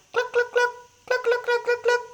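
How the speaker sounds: high clucking hen. A narrator's reading. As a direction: cluck like a big brown hen.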